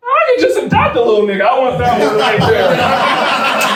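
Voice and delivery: high, loud, over-excited bit; eager outburst. Acted. Comedian jumping at a bargain, hammed-up eagerness. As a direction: burst out loudly as if picking one. Acts out eager reaction to price.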